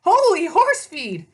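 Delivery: high, loud and slow horse panic; the horse drawing out his alarm; panicked pegasus. Acted. The pegasus dives under a thrown limo, high-pitched panic.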